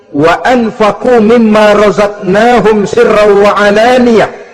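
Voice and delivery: slow, chanted Arabic verse; Quranic recitation. Acted. Preacher chanting a Quran verse to the congregation, slow and melodic. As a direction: intone the verse slowly and reverently.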